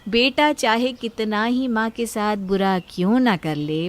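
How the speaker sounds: ordinary delivery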